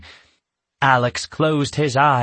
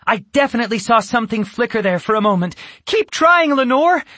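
fast, high urging; excited; 9 semitones higher, 3 dB louder, faster than usual. A narrator's reading. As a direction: rush out eager encouragement. Yasmin urging Lenore on.

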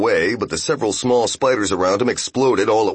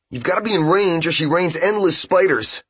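urgent call; 9 semitones higher than usual. Acted. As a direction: shout the tactic to the team. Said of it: "said Ippei".

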